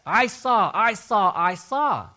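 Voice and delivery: preacher driving the point home to the congregation, loudly; emphatic declaring. Shown as a demonstration. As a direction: hammer home each "I saw" like a witness. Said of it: "He, yes".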